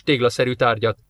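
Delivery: usual speaking voice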